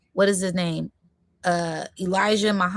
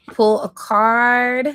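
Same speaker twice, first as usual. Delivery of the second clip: drawn-out call; 4 semitones higher, 6 dB louder, much slower than usual. Real, not acted. Calling to pull a card.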